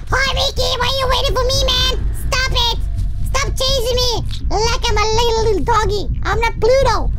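a hammed-up schoolyard tease; mock taunting. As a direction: taunt the cartoon mouse like a bratty kid. Taunting Mickey in the game.

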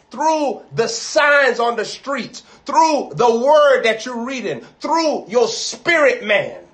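full-throated pulpit preaching; preaching at full force. Acted. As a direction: drive each phrase home to the church.

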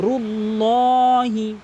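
রুল্লাহি with a long, full "lo". Shown as a demonstration. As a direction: slowly stretch a dark "lo" in রুল্লাহি. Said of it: "পুর করে বলতে ল বলতে হবে".

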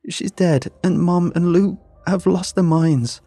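desperate pleading. A narrator's reading. Daughter begging her dad to go see, loud and desperate. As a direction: beg him to believe you, near shouting.

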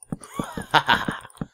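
high breathy giggle; short amused laugh. Real, not acted. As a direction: laugh at own bit. Laughs after mock line.

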